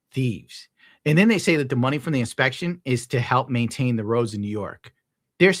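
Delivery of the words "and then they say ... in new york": ordinary talk, nothing marked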